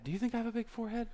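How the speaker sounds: put-on high, soft, quick girlish question; insecure girl. Girl asking her girlfriends about her looks, insecure. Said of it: "you girls were hanging out with your friends".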